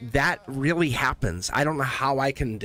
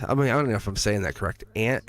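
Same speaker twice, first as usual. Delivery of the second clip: ordinary talk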